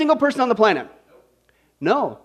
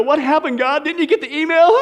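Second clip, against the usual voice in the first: mock complaint to God, higher and laughing; mock whine; 5 semitones higher than usual. Preacher mimics believers griping at God, mock-whiny with a laugh. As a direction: whine at God like a neglected customer.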